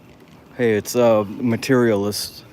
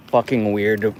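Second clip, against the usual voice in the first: ordinary talk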